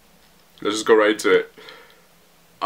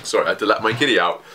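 normal talking voice

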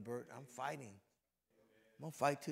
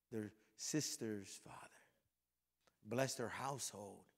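softer, lower, tender blessing over the men; quiet prayer of blessing; 6 semitones lower, 4 dB quieter than usual. Acted. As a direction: bless each family gently as the prayer winds down.

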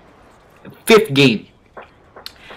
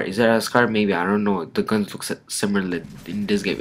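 ordinary talk, nothing marked

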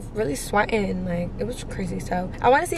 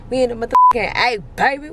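loud, fast, hyped-up brag; loud fast hometown hype; hyped shout; 4 semitones higher, 6 dB louder, faster than usual. Real, not acted. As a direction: shout it out like you just landed home. Celebrating arrival in Atlanta.

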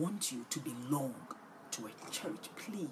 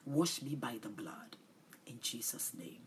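soft prayer; 6 dB quieter than usual. Shown as a demonstration.

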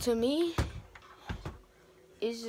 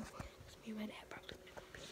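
whisper; 3 semitones higher, 14 dB quieter, faster than usual. Real, not acted. Whispering plans to go fishing.

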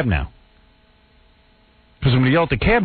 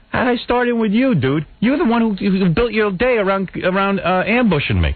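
mock outraged pushback; exasperated retort; 8 semitones higher than usual. Host confronting the paparazzo, incredulous exasperated retort. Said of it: "I say".

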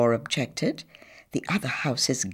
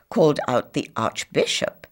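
ordinary delivery, nothing marked

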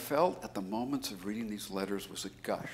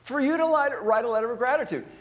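exasperated rant; 10 dB louder than usual. Real, not acted. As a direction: throw up your hands in loud disbelief. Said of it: "It makes me crazy".

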